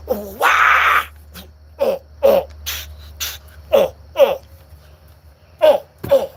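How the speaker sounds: punchy staccato vocal grunts; sung-style wail and grunts. Pretend. Mimics the song's grunted noises.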